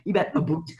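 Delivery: mock Canadian "aboot"; Canadian accent. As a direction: play up a Canadian accent lightly. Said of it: "Canadian pronunciation".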